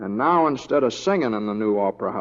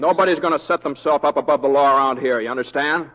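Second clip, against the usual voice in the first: stern warning; 3 semitones higher, 7 dB louder than usual. Acted. Stands up to a cattleman.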